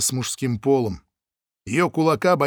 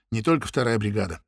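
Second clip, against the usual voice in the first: plain reading voice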